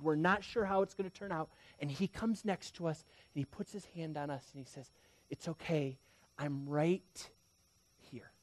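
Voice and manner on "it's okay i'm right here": soft comfort with pauses between phrases; gently reassure, letting the last word wait; tender reassurance. Acted. God comforting the terrified, retold.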